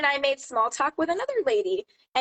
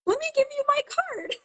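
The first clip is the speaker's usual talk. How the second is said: very high, sing-song eagerness; eager retold offer. Seller offering her card to the store worker, high and eager. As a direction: re-enact a chirpy, high-pitched offer.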